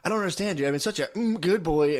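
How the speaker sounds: high, whiny friend voice; mock a whining buddy; high pleading good boy; whiny imitation. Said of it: "he'll be like".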